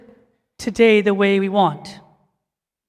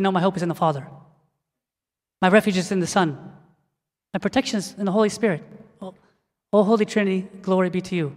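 reverent prayer recitation; 3 semitones lower, 6 dB quieter, faster than usual. Acted. Preacher leading church in a short prayer, soft reverent recitation. Demonstrates prayer against lies.

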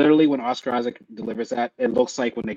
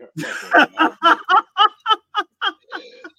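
laughing hard at the line; big high laugh; 4 dB louder than usual. Real, not acted.

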